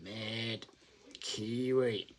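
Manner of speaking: said softly to himself while typing the name; quiet dictation. Shown as a demonstration. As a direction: murmur the name as you jot it down. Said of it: "Let's get your name down".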